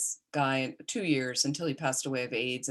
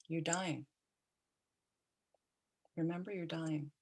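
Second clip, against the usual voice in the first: soft, matter-of-fact bedside reminder; calm and factual, gently hushed; 8 dB quieter than usual. Retold or imitated. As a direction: say it quietly, with long calm pauses. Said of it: "very calmly".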